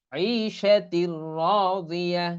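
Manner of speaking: slow recitation of আইশাতির রাদিয়া; merged without ghunnah. Shown as a demonstration. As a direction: roll আইশাতির straight into রাদিয়া on a doubled r. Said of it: "এখানে গুন্না হবে না".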